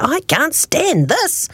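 high, slow fed-up wail; exasperated bear. A narrator's reading. Sleepless Mister Bear disturbed by garden animals, exasperated. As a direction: stretch each word in exasperation.

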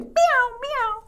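high, piercing double meow; alarmed cat meows. Acted. Startled cat cries out.

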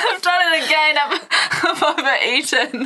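high, laughing through the words; laughing quote. Retold or imitated. Retelling Essie overeating again, laughing high. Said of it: "she was like".

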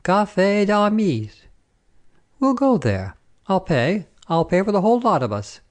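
high, generous announcement; eager soldier. A narrator's reading. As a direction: declare it grandly, pitched well up. Soldier grandly offers to pay.

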